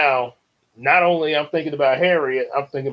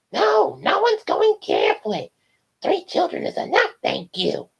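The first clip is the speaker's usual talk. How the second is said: much higher, put-on mom voice; Marge voice. Mom refusing Bart's request, high put-on voice. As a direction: scold sweetly like a cartoon mom. Mother answers Bart in story.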